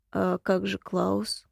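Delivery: slow, low, hushed spirit voice; soft rustling tree voice. A narrator's reading. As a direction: let it drift like wind in leaves. Unseen voice asks.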